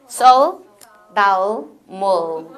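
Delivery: Korean words in an English accent, dark final l. Shown as a demonstration. Teacher saying Korean words as English to trainees, English accent. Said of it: "영어로 발음해 볼게요".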